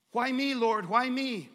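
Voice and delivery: mock exasperated cry; loud pleading. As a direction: plead loudly, whining to the Lord. Pleading complaint to God.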